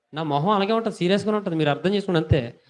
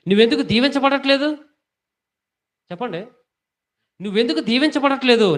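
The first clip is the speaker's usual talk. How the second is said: high, loud rebuking sermon voice; full-force preaching; 7 semitones higher, 4 dB louder than usual. Acted. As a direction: drive the rebuke home from the pulpit. Pastor rebukes congregation on giving. (గట్టిగా, ఎత్తైన స్వరంతో గద్దించే ప్రసంగం)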